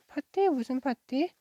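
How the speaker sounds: high-pitched puzzled question, leaping up at the start; surprised questioning. A narrator's reading. Friend invited to a party at narrator's house, surprised. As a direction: react with sudden surprise to the news.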